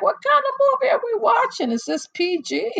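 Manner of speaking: watching the movie, high fast incredulous squeal. Retold or imitated. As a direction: squeal it in disbelief. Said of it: "I'm like".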